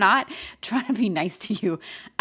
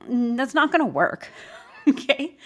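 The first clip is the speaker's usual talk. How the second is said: laughing through it, higher and louder; 7 semitones higher, 5 dB louder than usual. Real, not acted. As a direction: chuckle the refusal out. Laughing at an absurd request.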